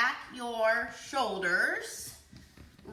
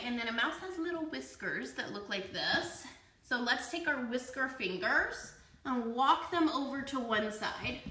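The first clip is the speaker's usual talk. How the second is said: normal talking voice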